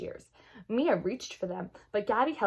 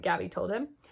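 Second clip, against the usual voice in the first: plain reading voice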